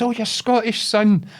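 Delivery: high-pitched old Scottish woman impression; imitated old lady, high and eager; wee old woman. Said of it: "this wee old woman".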